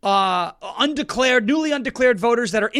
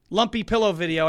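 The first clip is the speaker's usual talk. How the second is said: ordinary talk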